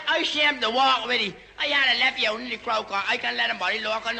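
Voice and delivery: ordinary delivery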